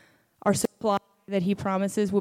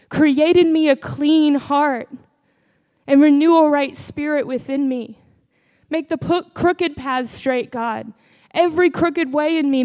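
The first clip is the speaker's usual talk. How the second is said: fervent prayer; 3 semitones higher, 4 dB louder than usual. Acted. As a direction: cry out to God in earnest.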